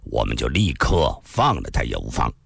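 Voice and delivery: unhurried, weighing each word; an elder speaks slowly to ask for fair judgement; slow and measured. Acted. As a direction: lay it out calmly and slowly. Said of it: "易明湖缓缓地说道".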